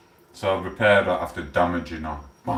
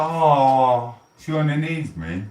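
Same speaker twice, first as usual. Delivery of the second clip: higher, mock-touched sigh; 6 semitones higher, slower than usual. Repeating his friend's words, higher mock-touched coo. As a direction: coo like you're melted. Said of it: "I was like".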